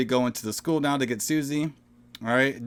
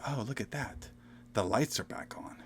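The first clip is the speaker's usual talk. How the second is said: quiet relieved; 9 dB quieter than usual. Retold or imitated. Retelling mother's relieved line.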